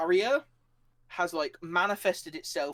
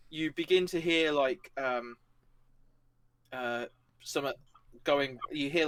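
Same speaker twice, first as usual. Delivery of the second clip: usual speaking voice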